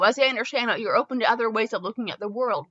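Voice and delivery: ordinary talk, nothing marked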